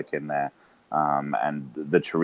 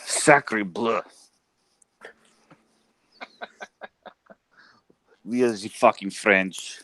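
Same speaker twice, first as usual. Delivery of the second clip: slow, botched French voice sliding toward Russian; bad French accent; 3 semitones higher, slower than usual. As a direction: fake a Frenchman badly. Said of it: "that wasn't even French. That was, like, fucking Russian".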